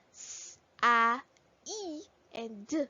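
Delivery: S as a hiss, A as "ah", E as "ee", D as "duh"; letter sounds. Shown as a demonstration. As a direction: sound out "S, A, E, and D" one by one. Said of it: "which sounds".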